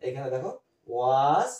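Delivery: was ধীরে আলাদা করে বলা; ধীরে টেনে was দেখাও; stressed was. Shown as a demonstration. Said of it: "was আর were থাকলে". (ধীরে জোর দিয়ে was)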